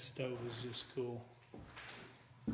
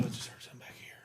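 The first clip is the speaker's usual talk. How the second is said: anxious whisper; nervous night vlog whisper; faster than usual. Real, not acted. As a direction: whisper it, spooked. Hears noise in dark building.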